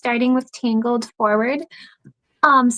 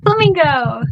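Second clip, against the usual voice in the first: big reveal; 3 semitones higher, 5 dB louder than usual. Pretend. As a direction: reveal the answer with a flourish. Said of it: "the answer is".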